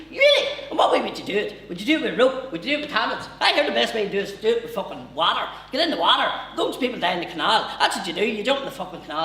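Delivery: high, loud Newry man; eager squeaky Newry voice; Newry accent. Retold or imitated.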